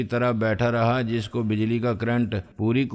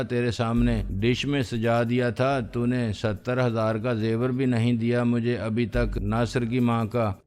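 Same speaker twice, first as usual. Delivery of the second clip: usual speaking voice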